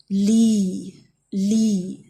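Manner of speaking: لی with a clear long ee; لی not said as le; long ee sound. Shown as a demonstration. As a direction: stretch لی as a pure ee. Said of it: "لے نہیں کہیں گے".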